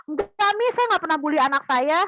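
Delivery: high, loud protesting parent; indignant mock parent insisting; defensive denial. Parent denying bullying own child.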